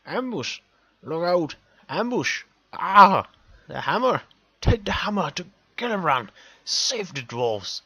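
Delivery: urgent high shouts with a loud cry; delirious shouting. A narrator's reading. Dying dwarf warning of an ambush, shouting. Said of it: "starts to shout".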